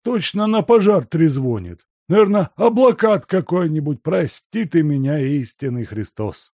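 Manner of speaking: put-on high, loud fussy old servant griping; irritated grumble. A narrator's reading. Servant grumbling at the door about the bell, high and irritated. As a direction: gripe about the ringing like a cranky old man.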